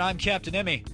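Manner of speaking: ordinary talk, nothing marked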